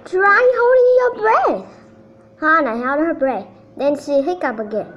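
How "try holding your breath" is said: loud advice. A narrator's reading. Helpful friend advising.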